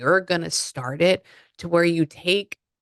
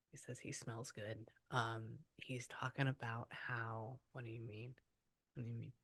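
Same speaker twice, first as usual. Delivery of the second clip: very quiet, low murmur; hushed; 5 semitones lower, 23 dB quieter than usual. Real, not acted. Medium listening to a spirit, hushed. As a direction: speak low, half to yourself.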